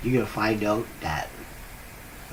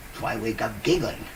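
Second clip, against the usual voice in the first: ordinary talk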